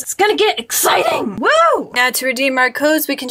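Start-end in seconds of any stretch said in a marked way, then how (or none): 0.0-1.9 excited shout. Real, not acted. Vlogger hyping viewers for the code reveal, excited shout. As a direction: cheer it out, hyped.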